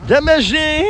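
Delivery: high mock announcement; mock title. Daughter announcing a mock title, pitched high. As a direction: announce it like a caption.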